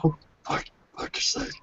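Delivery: hushed werewolf grumble under the breath; soft, barely voiced werewolf swearing; deep werewolf voice. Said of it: "deep werewolf voice".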